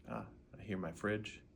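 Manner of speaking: ordinary talk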